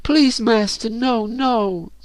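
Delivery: high, wailing falsetto begging; theatrical high-pitched desperate plea; howling plea. A narrator's reading. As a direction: wail and beg in a girl's high voice. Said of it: "howled Elizabeth".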